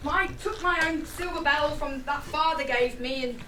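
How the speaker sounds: usual speaking voice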